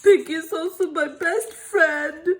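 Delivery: loud tearful lament; crying. Acted. Gerald crying to Doctor Cat about Piggy. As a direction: sob out the words.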